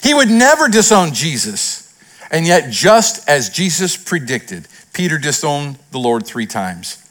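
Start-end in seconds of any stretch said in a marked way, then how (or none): none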